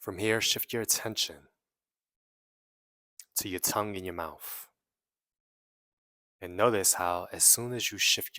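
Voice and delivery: measured meditation guide with long pauses; guided meditation. Real, not acted. Guiding listeners to release trapped emotions, in an unhurried meditation voice. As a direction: lead them inward phrase by phrase, leaving long silences.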